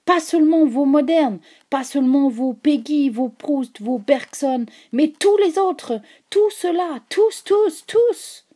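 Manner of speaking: building from loud to a high shrieking climax; rising frantic crescendo; mounting alarm. A narrator's reading. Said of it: "comme si nous n'avions pas compris".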